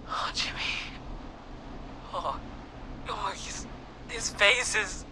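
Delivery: horrified, hushed and breathless; barely able to speak from shock; shocked whisper. Acted. Shocked at friend's body.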